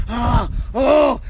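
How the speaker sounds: mocking strained grunts, drawn out; exaggerated grunts. Woman mimicking men lifting her bag, exaggerated grunts. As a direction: grunt as if hefting a huge weight. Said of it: "And they grunt".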